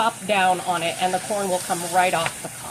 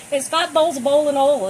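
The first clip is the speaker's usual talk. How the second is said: Southern accent; 4 semitones higher, 6 dB louder than usual. Shown as a demonstration. Cook shows off her Southern accent tongue twister, Southern accent. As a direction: say it like she did living in Alabama, louder. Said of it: "in a Southern accent".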